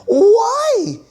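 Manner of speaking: high, loud, crying why; hammed-up sob of despair; mock wail. Mock lament over Disney.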